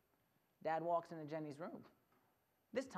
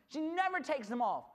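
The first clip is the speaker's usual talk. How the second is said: usual speaking voice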